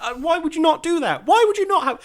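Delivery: high-pitched, indignant protest; getting angry. Real, not acted. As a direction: demand it in rising exasperation. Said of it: "I'm getting angry about this now".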